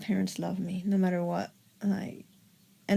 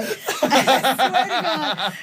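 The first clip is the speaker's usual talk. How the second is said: slow, louder oath through laughter; laughing; 4 semitones higher, 5 dB louder, much slower than usual. Real, not acted. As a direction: swear it while cracking up. Swears while laughing at own story.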